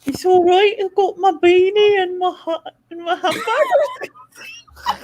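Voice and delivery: high, loud, drawn-out mock Brummie; Steve Green. Impersonating a Birmingham friend on request, hammed-up Brummie. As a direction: do an exaggerated Brummie, cracking up at the end. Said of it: "I'd speak like Steve then".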